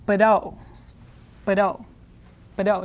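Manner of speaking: "bdo" said as one quick syllable, r tapped like a fast d. Shown as a demonstration. Teacher shows learners the second syllable, said quickly as one. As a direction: run b and tapped r together in "bdo". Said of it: "you have to say it quickly".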